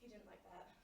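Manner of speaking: hushed and low, almost to herself; a low, muted first take; quiet. Acted. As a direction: murmur it under the breath. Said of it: "he really didn't like that".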